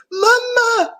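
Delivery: very high frightened cry; crying for mama. A narrator's reading. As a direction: wail for his mother. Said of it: "cried Abu".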